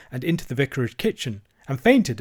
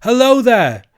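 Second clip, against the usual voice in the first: high, loud hail; shouting; 10 semitones higher, 10 dB louder than usual. A narrator's reading. Cyril hailing from the church tower, shouting. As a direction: call down from a height. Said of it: "shouted Cyril from the church tower".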